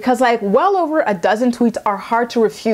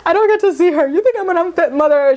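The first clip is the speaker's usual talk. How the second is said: high, hammed-up fake sob; a high, mock crocodile-tears wail; mock fake crying; 7 semitones higher than usual. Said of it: "crocodile tears".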